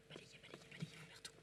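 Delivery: unvoiced stage whisper. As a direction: whisper it to the assistant. Whispered instruction to assistant.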